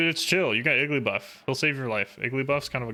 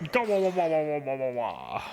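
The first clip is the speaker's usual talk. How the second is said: fading mock wah chant; wah wah noise; 3 semitones higher than usual. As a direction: blare out a cartoon wah. Imitating a mocking noise.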